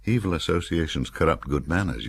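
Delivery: ordinary delivery, nothing marked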